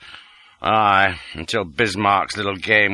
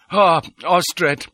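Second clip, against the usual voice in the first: raised voice of a man hailing the doctor; higher, calling him over; Detchard greeting; 8 semitones higher, 4 dB louder than usual. A narrator's reading. Detchard greets the little doctor as he enters, raised voice.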